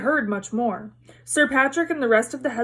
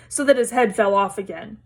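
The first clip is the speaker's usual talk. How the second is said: ordinary delivery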